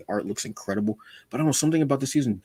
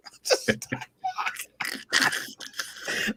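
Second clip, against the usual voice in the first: high, breathless wheezing laughter; helpless high laugh; 5 dB quieter than usual. Real, not acted. A fan cracking up over a cartoon line, high wheezing laugh. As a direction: crack up until the voice squeaks.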